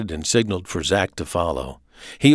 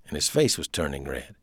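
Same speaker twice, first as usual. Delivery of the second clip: ordinary delivery, nothing marked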